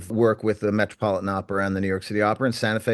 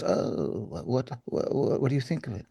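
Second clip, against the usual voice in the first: imitating Flora; 9 dB quieter than usual. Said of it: "She goes".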